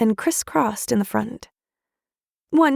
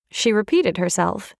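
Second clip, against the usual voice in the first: plain reading voice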